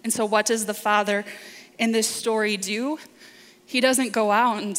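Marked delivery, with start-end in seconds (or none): none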